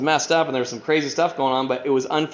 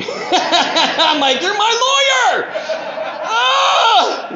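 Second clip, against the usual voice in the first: much higher, loud laughing protest; laughing outrage; 6 dB louder, much slower than usual. Retold or imitated. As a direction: cry out in disbelief through laughter. Retelling outrage at dad.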